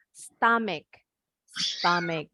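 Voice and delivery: stomach said "STAH-mek"; O pronounced as A. Shown as a demonstration. Teacher correcting the misreading for students, stomach with O as A. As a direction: say stomach with an open A in place of O. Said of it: "Jadi huruf O-nya bunyinya A".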